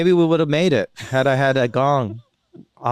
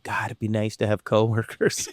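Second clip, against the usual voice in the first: wistful; 3 semitones lower, 5 dB quieter than usual. Retold or imitated. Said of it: "So sad".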